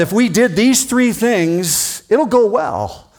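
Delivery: quoting Dad, slow and lifted; imitating Dad. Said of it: "But Dad said".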